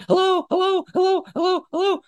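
very high, squawky parrot hellos; parrot call. Retold or imitated. Parrot answering a favourite visitor, excited parrot call. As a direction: mimic a parrot chirping back over and over.

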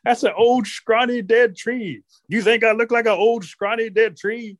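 high, mock-offended old wife; indignant grandma. Grandma indignant at being compared.

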